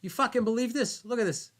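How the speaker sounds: loud, high Boston outburst; Bill Burr impression. Host retelling Bill Burr yelling at the game, loud Boston. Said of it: "Bill turns around and goes".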